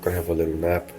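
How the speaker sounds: ordinary delivery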